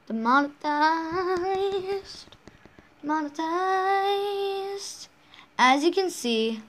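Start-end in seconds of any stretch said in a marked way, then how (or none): 0.1-5.1 very slow, higher, stretched syllables; drawn-out word. Pretend. Player toying with the fear of being demonetized, long drawn out. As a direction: stretch the word out playfully, almost sung. Drawing out the word after copyright worry.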